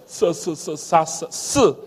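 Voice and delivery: soft hissed "S" drilled again and again; Chinese s sound. Shown as a demonstration. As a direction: model the sound for the class to repeat. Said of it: "Esse som nós não temos".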